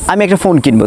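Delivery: usual speaking voice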